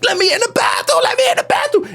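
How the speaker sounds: high, wailing plea; crying child pleading. Acted. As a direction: beg through mock tears to get in. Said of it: "I'm crying".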